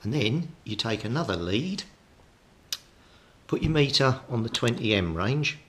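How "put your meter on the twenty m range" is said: usual speaking voice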